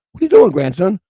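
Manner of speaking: high, loud exasperated demand; upset with grandson. Retold or imitated. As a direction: confront the grandson in disbelief. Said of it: "I got really upset".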